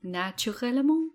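French word, lightly lifted in pitch; speaking French. Shown as a demonstration. Showing off French for co-host, speaking French. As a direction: say it with a French pronunciation. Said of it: "Can you speak some French for us".

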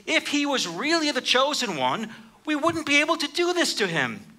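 high, scornful mockery; mocking the one on the cross, voice pitched high; mocking rulers. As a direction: sneer it like a smug ruler. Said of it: "religious people mock him".

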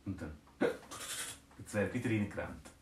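ordinary delivery, nothing marked

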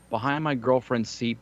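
usual speaking voice

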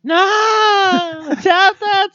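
high, slow, hammed-up crying plea; mock wail. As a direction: wail it like fake heartbreak. Mock wail at show end.

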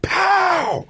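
sharp high mock gunshot pop; a quick vocal pow for a spring snapping; imitated bang. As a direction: spit out the bang like a gun going off. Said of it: "I just heard this".

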